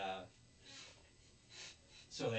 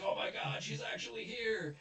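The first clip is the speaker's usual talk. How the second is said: squeaky, much higher thrilled voice; giddy excitement; 12 semitones higher, 3 dB louder than usual. Retold or imitated. Officer thrilled the TV girl is at his door. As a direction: gush like a starstruck fan. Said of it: "And he's like".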